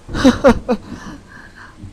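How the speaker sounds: loud high scornful laugh. Acted. Detective scoffing at himself, loud high laugh. Detective mocks his own fear.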